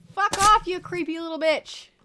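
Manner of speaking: loud, high furious yelling; scared horror game streamer. Real, not acted. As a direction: yell at the attacker in panic. Fending off attacking emu.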